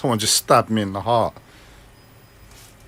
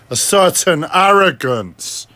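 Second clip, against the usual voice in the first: slow, higher, heavily leaned-on arrogance; certain arrogance; 5 semitones higher, 4 dB louder, slower than usual. Shown as a demonstration. Mocking the tone of his own voice, arrogant. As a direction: drag it out with exaggerated arrogance. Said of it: "speaking with a certain tone".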